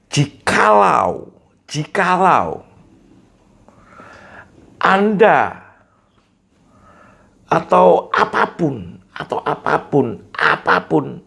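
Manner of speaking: crisp, high, overarticulated "jikalau" and "apapun"; articulation demo. Shown as a demonstration. Teacher showing clear articulation to the audience. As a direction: spell out each sound of "apapun" clearly. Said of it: "Jadi, jelas, itu artikulasi".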